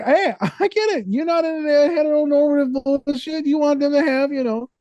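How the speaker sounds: high, put-on understanding voice; mock understanding. Counselor hamming up sympathy to client, high put-on voice. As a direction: play the overly supportive ally.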